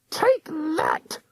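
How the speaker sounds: spring out with a witch's cry; hag's scream. A narrator's reading. Ghost hunter ambushing Eric, shrill cry. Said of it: "Missus Croker screamed".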